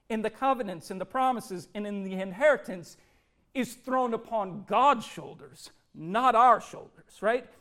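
ordinary talk

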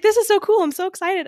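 high, loud squeal of excitement; squealing like a thrilled fan; excited squeal. Retold or imitated. Fan meeting an author, squealing high with excitement. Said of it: "I was just like".

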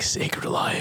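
hushed whisper of the name. Retold or imitated. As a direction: breathe the words reverently. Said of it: "It's like".

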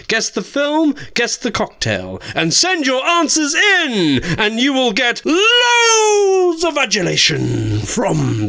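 high, drawn-out diva sign-off; campy drag queen. Pretend. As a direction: sing it out like a glam diva. Host sign-off, princess persona.

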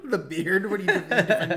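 laughing high and loud. Real, not acted. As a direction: crack up mid-question. Laughing at friend's beard line.

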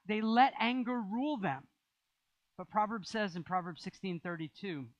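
ordinary talk

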